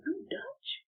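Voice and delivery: high, soft incredulous question; imitated disbelief. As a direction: ask it softly, pitched right up. Man amazed she is Dutch.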